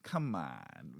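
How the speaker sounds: ordinary talk, nothing marked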